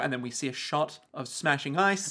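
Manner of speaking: ordinary delivery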